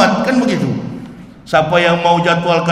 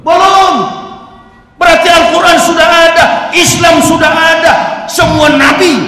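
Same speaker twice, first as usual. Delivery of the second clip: higher, much louder preaching shout; shouted proclamation; 9 semitones higher, 7 dB louder than usual. Real, not acted. Preacher shouting his proof at the congregation, louder and higher. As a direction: thunder it at the crowd.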